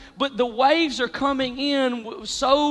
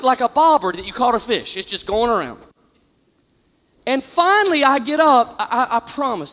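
ordinary talk

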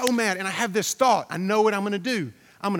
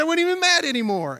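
high, loud imitation of a delighted friend; imitating a friend's giddy discovery, pitched way up; retold elation; 9 semitones higher, 5 dB louder than usual. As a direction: raise the pitch and volume, playing his amazed relief. Friend's delighted surprise after laughing, retold.

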